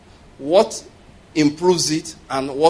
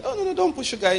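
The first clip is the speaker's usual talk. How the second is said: pitched up, fussy protest; high mock fuss; 11 semitones higher, 4 dB quieter, faster than usual. Convention guest fussing about sugar, high mock voice. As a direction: squeak the alarm about sugar. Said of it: "So somebody said".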